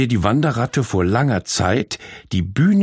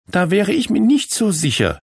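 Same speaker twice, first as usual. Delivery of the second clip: contradicting; 9 semitones higher than usual. Acted. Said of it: "widersprach ihr Malu".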